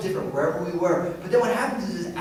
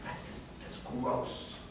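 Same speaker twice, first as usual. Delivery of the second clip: much softer, half-whispered mock disgust; disgusted dismissal; 6 semitones lower, 17 dB quieter than usual. Mocking Christians shunning outsiders, hushed disgusted voice. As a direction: wave them off with quiet contempt. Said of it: "looking at someone and saying".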